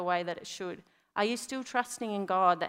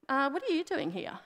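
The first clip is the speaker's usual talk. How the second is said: higher-pitched, puzzled mock question; mock literal questioning; 6 semitones higher than usual. Preacher parodying a clueless God asking Elijah, puzzled. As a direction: ask it as if you truly have no idea. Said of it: "God's not literally saying".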